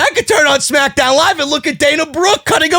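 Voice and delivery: high, fast, shouted derision; amused ridicule. Real, not acted. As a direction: shout it up high, beside yourself at the absurdity. Ridicules promo after laughing fit.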